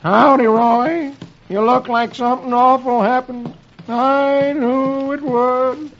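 high, slow, mournful cowpoke whine; Doleful Hawkins. A narrator's reading. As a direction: expect the worst in a drawn-out high voice. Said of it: "Doleful greets him".

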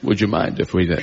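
low-pitched Scottish film-star impression; mock Scottish star voice, pitched well down; Sean Connery. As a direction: drop the pitch and imitate a Scottish actor. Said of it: "I have to do Sean Connery".

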